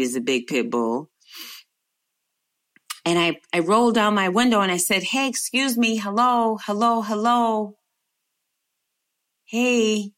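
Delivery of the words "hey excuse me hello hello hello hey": calling out. Retold or imitated. Calling to man from car.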